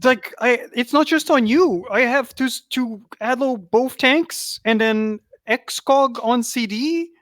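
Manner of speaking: worked-up, pitched high; venting in frustration; exasperated. Real, not acted. Frustrated rant about healing.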